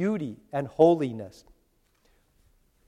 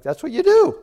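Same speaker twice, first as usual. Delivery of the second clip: higher, louder mimicked protest; mock excuse; 14 semitones higher, 7 dB louder than usual. Preacher mimicking young people excusing their cussing, high mock protest. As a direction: toss out the excuse high and loud.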